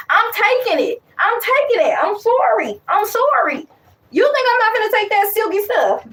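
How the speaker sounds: rising high, giddy and unapologetic; excited. Real, not acted. Laughing confession to viewers about wanting silky hair, excited.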